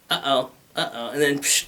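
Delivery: normal talking voice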